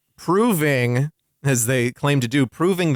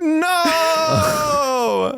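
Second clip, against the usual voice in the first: long, high-pitched mock cry of no; Ray Wise; 14 semitones higher than usual. Ray Wise reacting to justices converting overnight, mock anguished cry. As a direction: wail it out high, hammed-up.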